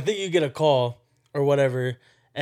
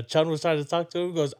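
ordinary talk

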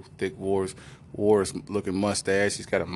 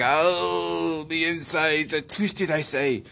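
put-on higher, slow, groaning Irish accent; mock Irish accent; 8 semitones higher, much slower than usual. Sick Malcolm on the plane, slow higher Irish moan. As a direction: draw out the ache in Irish. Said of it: "Malcolm's Irish voice".

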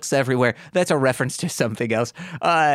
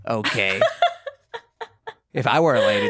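ordinary delivery

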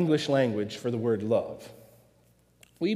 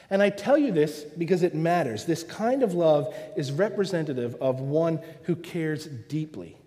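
usual speaking voice

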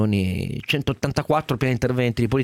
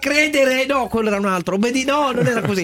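mock propaganda slogans; 12 semitones higher, 3 dB louder than usual. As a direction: preach faith in the government sarcastically. Mock regime slogans, ironic.

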